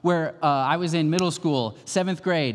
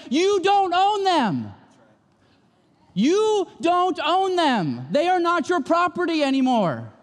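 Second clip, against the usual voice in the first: slow, high and loud proclamation; God declaring; 4 dB louder, slower than usual. Retold or imitated. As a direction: proclaim it with full authority. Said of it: "God is behind you saying".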